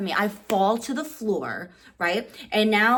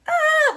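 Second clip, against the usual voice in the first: much higher, louder frustrated yell; frustrated cry; 8 dB louder than usual. Retold or imitated. Fan stuck in the crowd after the theft, loud high yell. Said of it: "And I'm just like".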